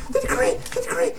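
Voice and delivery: fast, very high falsetto whimper; desperate squeal. Acted. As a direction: squeak it frantically, hammed up. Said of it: "desperately grabbing the piece of paper".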